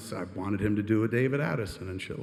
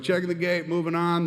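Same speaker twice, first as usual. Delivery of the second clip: raised-pitch set announcement; a higher, sustained crew call; 6 semitones higher than usual. Retold or imitated. AD calling out to crew.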